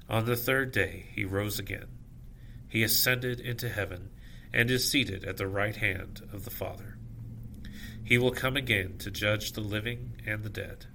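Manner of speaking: ordinary talk, nothing marked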